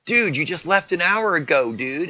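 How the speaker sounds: high, loud acted-out accusation; heated accusation. Boyfriend challenges girlfriend about leaving an hour ago, heated accusation.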